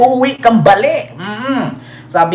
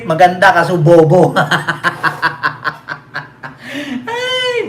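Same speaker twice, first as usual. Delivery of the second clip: usual speaking voice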